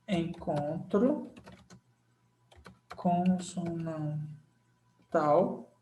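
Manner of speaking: slow, drawn-out syllables for dictation; slow demo. Shown as a demonstration. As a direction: spell it out slowly for the class. (bem devagar, sílaba por sílaba)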